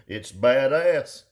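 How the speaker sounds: loud, drawn-out mock hype; mocking boast. Quoting people who slap shit together, mocking. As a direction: stretch it out in mock pride. Mocks other builders' claim.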